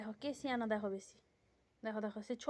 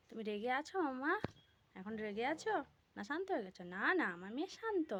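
soft tender soothing; 8 dB quieter than usual. Real, not acted. Mother soothing daughter softly.

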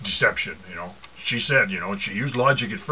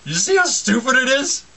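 mocking high voice; 13 semitones higher, 4 dB louder than usual. Real, not acted. High put-on voice to congregation.

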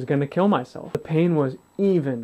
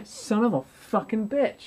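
drawn-out, higher groan of a curse; pained curse; 7 semitones higher, 3 dB quieter, slower than usual. Retold or imitated. Alone at home, pain returning, slow pained curse.

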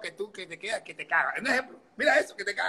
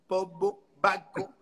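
normal talking voice

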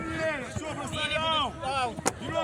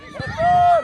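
loud high call across the pitch; shouting; 14 semitones higher, 9 dB louder than usual. Real, not acted. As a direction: bellow to the keeper. Coach calls the goalkeeper. (громкий крик)

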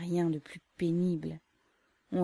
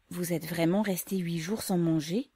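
ordinary delivery

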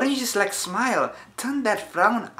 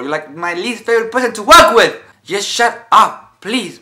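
louder, exasperated tirade; angry rant; 4 semitones higher, 8 dB louder than usual. Acted. Coworker tells Margaret off, loud and exasperated. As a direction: push it louder as the frustration boils.

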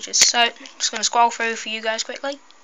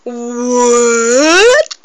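losing my mind; 8 dB louder than usual. Real, not acted. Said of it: "If I sound a bit crazy".

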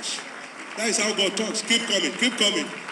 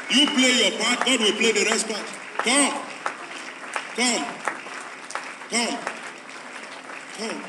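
loud, high calling out to the crowd; preaching at full force; 5 semitones higher, 4 dB louder, slower than usual. Real, not acted. Preacher calling people to the altar, full force. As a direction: urge them forward, louder and higher.

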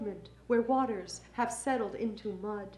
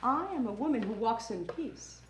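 lower, softer, settled close; calm assurance; 4 semitones lower, 8 dB quieter than usual. Acted. Poet closing on self-knowledge, calm and low. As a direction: drop down and soften to a quiet ending.

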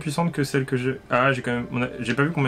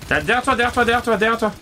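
urgent warning shout; 8 semitones higher than usual. Real, not acted. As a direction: warn them fast, enemy behind. Warning of enemy behind.

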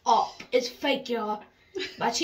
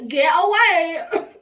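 mock cry; 3 semitones higher, 7 dB louder, slower than usual. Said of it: "I was like".

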